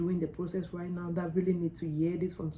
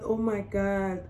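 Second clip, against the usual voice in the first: normal talking voice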